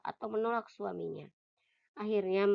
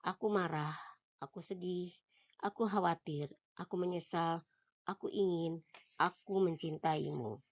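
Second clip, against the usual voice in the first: softer, gentle reading of each feeling phrase; soft sample phrases; 3 semitones lower, 10 dB quieter than usual. Acted. Narrator offering sample feelings to the reader, softly.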